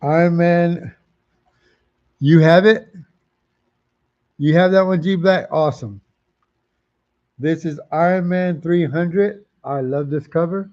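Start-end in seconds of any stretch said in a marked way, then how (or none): 2.2-5.5 calling out to viewer. Real, not acted.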